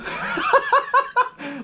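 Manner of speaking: high-pitched giggling burst; high laughing. Real, not acted. Retelling the waving drunk sheriff, helpless high laughter. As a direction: crack up at your own story. Amused by his own retelling.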